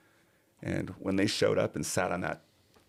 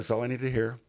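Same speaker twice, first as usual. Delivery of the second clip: decisive benefactor; 6 semitones higher, faster than usual. Retold or imitated. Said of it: "she's like".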